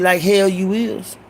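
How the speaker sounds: slow, deliberate mock-stern mother; stern mama. Mama refuses the boy's excuse, slow and stern. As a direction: drag it out like a mother not buying it. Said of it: "She said".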